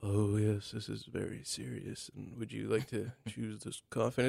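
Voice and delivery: very soft, low undertaker voice; mock-solemn hush, playing the reserved undertaker; rehearsed funeral caretaker. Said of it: "funeral caretaker has to do this rehearsed".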